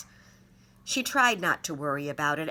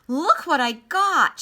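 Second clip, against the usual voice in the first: put-on high, loud, drawn-out excited child; girl showing her parents the quarter; 11 semitones higher, 9 dB louder, slower than usual. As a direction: squeal it slowly, bursting with pride. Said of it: "she said".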